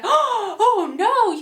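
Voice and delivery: high, acted crowd gasp of dismay; mock-horrified onlookers; shocked dismay. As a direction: react aghast, pitch leaping up. Crowd aghast at groom's silly clothes.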